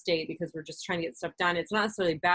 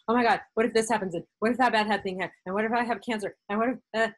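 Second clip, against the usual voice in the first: higher, louder, fast worried rush; anxious spiral; 4 semitones higher, 3 dB louder, faster than usual. Pretend. Speaker voicing racing anxious thoughts, fast and fearful. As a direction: let the what-ifs tumble out in panic.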